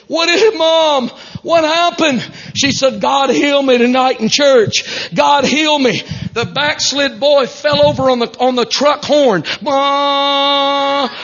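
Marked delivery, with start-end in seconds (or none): 0.1-2.2 alarmed son. Retold or imitated. 3.0-6.0 joyful announcement. Retold or imitated. Mom announcing her healing. 9.6-11.1 son sobbing out mom, raised and high; crying out. Retold or imitated. Son weeping over his healed mother, calling out. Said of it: "crying with his head on the horn".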